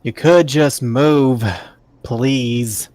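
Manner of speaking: slow loud call across the road; shouted. Acted. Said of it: "I'm gonna shout out".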